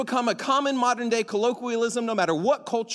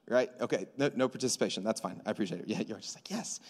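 quiet aside; 10 semitones lower, 9 dB quieter, faster than usual. Real, not acted. Teasing silent congregation.

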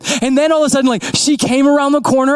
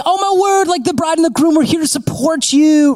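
high, excited gush; gushing wedding guest; 6 semitones higher than usual. Retold or imitated.

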